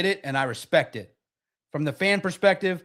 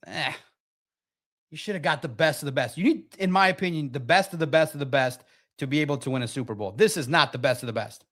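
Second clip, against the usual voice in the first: usual speaking voice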